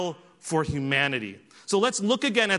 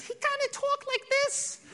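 very high, squeaky little voice; mocking high-pitched falsetto imitation; squeaky Tyson impression; 5 dB quieter than usual. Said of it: "he talks in this squeaky voice".

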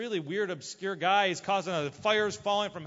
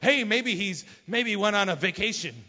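mocking taunt, starting loud; Elijah; 4 dB louder than usual. Elijah suggests their god is on vacation, mocking. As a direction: needle them, poking fun. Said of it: "he starts mocking them and make fun of them".

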